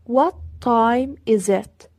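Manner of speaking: very slow, clear classroom question; deliberate teacher pacing; slow model question. Shown as a demonstration. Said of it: "teacher models question slowly".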